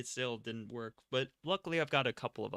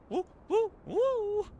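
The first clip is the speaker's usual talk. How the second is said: excited whooping; 9 dB louder, much slower than usual. Real, not acted. Cheering while bouncing ship.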